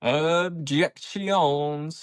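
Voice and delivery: slow, elongated "Objections"; drawn-out announcement. Pretend. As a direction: announce the topic in one long, playful stretch. Playfully announcing the topic.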